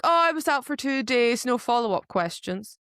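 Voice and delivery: sarcastic breezy imitation; mocking shrug. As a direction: mimic her breezy lack of concern. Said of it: "why on earth is she just like".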